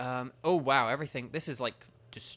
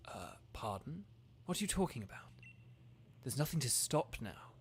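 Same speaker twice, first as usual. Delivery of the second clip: lower, soft villain voice; understated menacing Chairman Rose; reading Rose's lines; 5 semitones lower, 4 dB quieter than usual. Acted. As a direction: play the calm businessman villain, lower and quieter.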